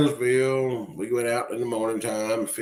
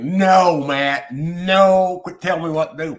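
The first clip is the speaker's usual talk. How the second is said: loud protest; 7 semitones higher, 8 dB louder, slower than usual. Real, not acted. Telling Matt off.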